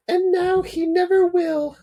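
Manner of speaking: a high tender mum; mother's voice. Acted. Mum at son's bedside, high falsetto mother voice. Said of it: "said Mum".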